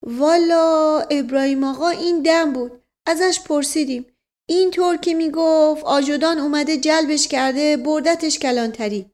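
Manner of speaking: high, loud-ish servant voice; high comic servant character; guileless servant. Acted. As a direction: answer the master in a high-pitched, earnest voice. Servant figure reports news.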